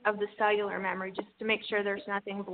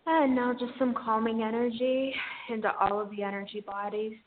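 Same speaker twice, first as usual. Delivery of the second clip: airy breathy mystical healer. Acted. Guides an energy healing session.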